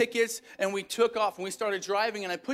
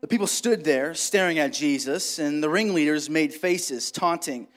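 dramatic scripture reading; 5 semitones lower than usual. Acted. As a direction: tell the passage like a solemn story. Reads Luke passage aloud.

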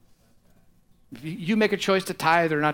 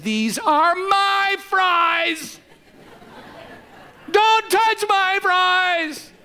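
put-on squealing, much higher, slow, loud toddler wail; crying child; 6 dB louder, much slower than usual. Little boy crying at his father over his fries. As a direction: wail like a kid guarding his plate. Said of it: "he cries and he says".

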